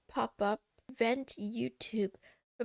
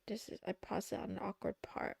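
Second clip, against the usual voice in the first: hushed; 3 semitones lower, 8 dB quieter than usual. Real, not acted. Hushed about paused clip.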